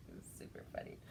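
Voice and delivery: whisper. Real, not acted. Whispered aside after laughing.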